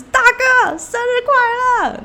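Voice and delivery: high, slow shouted greeting. Retold or imitated. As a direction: yell happy birthday across the room. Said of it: "他就大喊".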